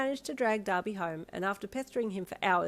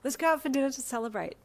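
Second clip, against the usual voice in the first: higher eager invite; excited; 7 semitones higher, 4 dB louder than usual. A narrator's reading. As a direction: bounce the idea out. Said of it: "you said excitedly".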